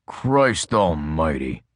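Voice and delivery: a hint of a weary cop; exasperated Adams. A narrator's reading. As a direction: drag it out, fed up.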